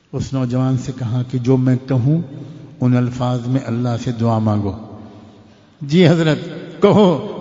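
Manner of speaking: usual speaking voice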